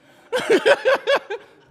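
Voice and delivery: very high burst of laughter; loud laugh. Real, not acted. Preacher laughing at the sailors' guilty prayer, loud laugh. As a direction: laugh hard.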